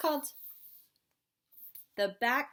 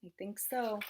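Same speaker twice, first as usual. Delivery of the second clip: ordinary talk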